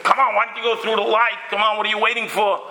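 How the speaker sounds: husband nagging his wife at the light, impatient. Retold or imitated. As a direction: hurry her up, exasperated. Impatient husband scolding wife driving.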